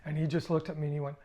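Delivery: usual speaking voice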